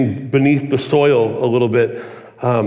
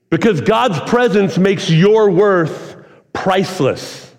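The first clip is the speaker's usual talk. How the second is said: slow emphatic; 3 semitones higher, slower than usual. Real, not acted. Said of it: "preacher stresses key line".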